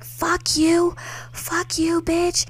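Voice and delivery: high-pitched mock cursing; imitated angry outburst. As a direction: act out an angry drunk sister, pitched high. Drunk sister's quarrel, acted out.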